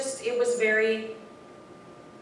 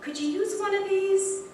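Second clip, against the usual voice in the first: very high, sing-song offer; eager offer; 13 semitones higher, 3 dB louder than usual. Retold or imitated. Offering hugs to stranded drivers, very high. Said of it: "and I'm like".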